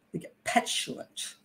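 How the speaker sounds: whispered aside. Shown as a demonstration. Echoing the word in a hush.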